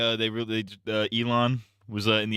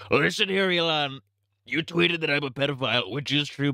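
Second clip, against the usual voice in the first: raised, put-on presidential voice; Trump impression; 4 semitones higher than usual. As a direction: address a rival as mock Trump. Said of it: "You think Trump gave Elon".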